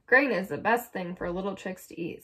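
usual speaking voice

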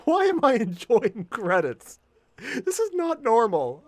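high, loud, baffled outburst; amused bewilderment. Real, not acted. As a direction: question yourself in loud, amused disbelief. Baffled, amused at enjoying the credits.

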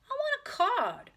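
put-on very high, loud, slow child demand; demanding little girl. A narrator's reading. Ramona wants her own library card, high loud demand. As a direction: demand it like a four-year-old.

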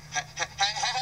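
usual speaking voice

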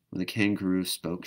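ordinary delivery, nothing marked

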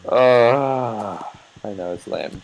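ordinary talk, nothing marked

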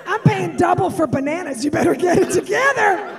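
hammed-up snooty shopper yelling; judgy shopper scolding. Judgy shopper scolds a tantrum mom, loud mocking shout. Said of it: "look that mother up and down".

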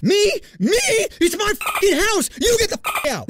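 high, indignant shouted fury; outraged scream. Retold or imitated. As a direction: scream back in disbelief at being told to leave. Uncle screaming at mom.

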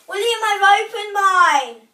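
shouted high across the house; calling out. Real, not acted. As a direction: call out loud to someone away. Calling to brother in another room.

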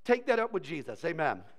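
normal talking voice

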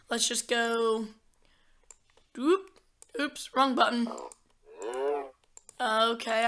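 ordinary talk, nothing marked

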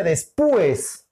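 después said as des-PÚ-es, stressed on the u; wrong stress on U. Shown as a demonstration. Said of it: "si le colocas en la U diría después". (después mal dicho, con la tilde en la u: despúes)

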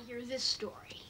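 teasing whisper. Acted. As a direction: whisper it slyly.

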